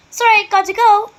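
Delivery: high, loud hurried reply; cried out. A narrator's reading. Grace calling back to her friends as she hurries off, high and loud. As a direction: call back while running. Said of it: "Grace cried".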